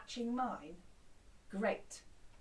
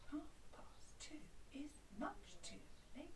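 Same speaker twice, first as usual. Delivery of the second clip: whisper; 2 semitones higher, 13 dB quieter than usual. Shown as a demonstration. Whispered echo of chant.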